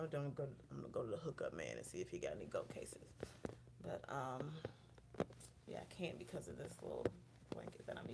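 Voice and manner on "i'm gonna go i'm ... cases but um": whispered to herself; breathy aside under her breath. Real, not acted. Vlogger fiddling with her camera setup, whispering to herself. Muttering to herself while setting up.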